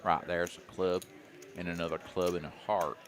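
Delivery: a hint of a backwoods Southern voice; southern accent. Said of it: "me doing the southern accent".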